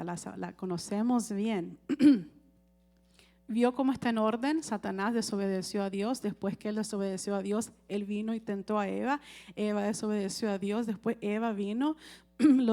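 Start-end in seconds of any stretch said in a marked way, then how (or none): none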